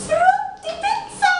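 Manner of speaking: very high, sung-out "frutti pizza!" with a little extra volume; showy mock-Italian cry of "frutti pizza!"; hammed-up flourish. Shown as a demonstration. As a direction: fling "frutti pizza!" up high like a grand announcement. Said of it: "I said".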